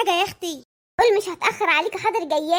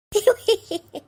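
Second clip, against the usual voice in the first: giggle; 4 semitones higher than usual. Acted. Wife giggling to her neighbour.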